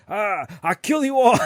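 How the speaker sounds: high slow mock yell; hammed-up angry yell; retold yell. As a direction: shout the threat like a furious dad. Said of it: "he's, like, yelling".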